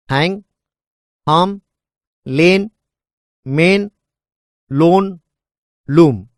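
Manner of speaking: each word said alone, with a pause after it; Hang, harm, lane, main, lone, loom spoken one at a time, each word given in full; demo word list. Shown as a demonstration. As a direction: say each word slowly and on its own. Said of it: "say the following words".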